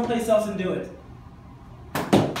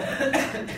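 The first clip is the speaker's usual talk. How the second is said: quick real chuckle; short laugh; 5 semitones higher than usual. Real, not acted. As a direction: laugh at your own point. Laughs at own point.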